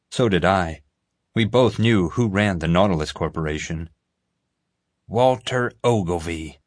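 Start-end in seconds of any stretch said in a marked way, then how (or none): 5.1-6.6 growled. A narrator's reading. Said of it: "J J growled".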